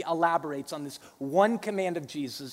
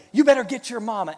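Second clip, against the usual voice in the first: playful dad threat; 6 semitones higher, 9 dB louder, faster than usual. Retold or imitated. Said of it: "and I'll say".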